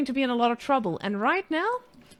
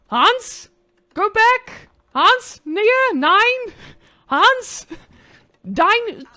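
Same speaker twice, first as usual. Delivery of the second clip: yelling at villager; 10 semitones higher, 9 dB louder, much slower than usual. Acted. Yelling at in-game villager Hans.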